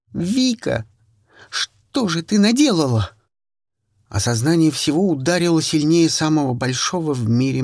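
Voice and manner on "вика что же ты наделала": shocked. Acted. Shocked reproach after confession.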